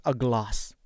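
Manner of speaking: a glass with a long open British a, slow; British accent. Shown as a demonstration. Teacher contrasts for students, British accent. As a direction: slowly say glass as glahss, British.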